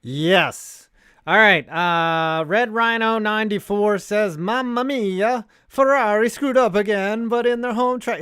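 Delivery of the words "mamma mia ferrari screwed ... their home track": hammed-up Italian, higher and louder. Sportscaster reading a fan comment in mock Italian accent. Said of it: "do a stereotypical Italian voice".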